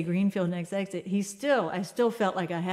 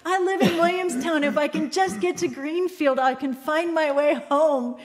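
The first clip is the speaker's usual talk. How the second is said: high, loud mock pleading; imitated desperate plea; 7 semitones higher, 5 dB louder than usual. Lost driver explaining himself to a passing motorist, desperate. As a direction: plead loudly, slowing down at the end. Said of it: "it was a plea".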